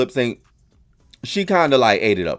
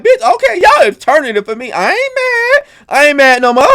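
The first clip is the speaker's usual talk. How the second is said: much higher, loud, hyped approval; won over; 13 semitones higher, 6 dB louder than usual. Retold or imitated. Host cheering the two finalists, hyped. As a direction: gush like a fan converted mid-show.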